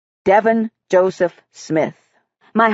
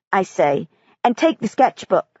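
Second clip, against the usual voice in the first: ordinary delivery, nothing marked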